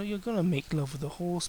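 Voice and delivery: ordinary talk, nothing marked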